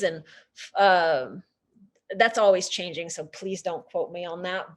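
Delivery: usual speaking voice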